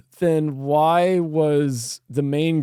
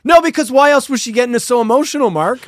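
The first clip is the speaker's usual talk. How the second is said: insistent protest; 7 semitones higher, 5 dB louder than usual. Real, not acted. Comically insists on his silly theory.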